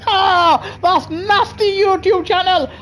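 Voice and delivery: high, wheezing laugh through the words; laughing hard. Real, not acted. Laughing at own joke about a chat girl, high wheezing laugh. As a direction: crack up mid-sentence.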